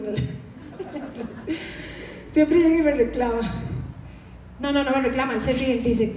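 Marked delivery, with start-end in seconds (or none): 0.8-2.2 soft breathy laugh at his own story; a quick airless chuckle. Real, not acted. Preacher chuckling to the congregation over his father.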